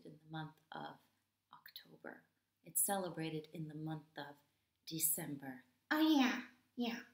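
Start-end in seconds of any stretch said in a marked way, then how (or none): 5.9-7.0 loud, high oh yeah; sudden realization. Acted. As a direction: blurt it as the penny drops.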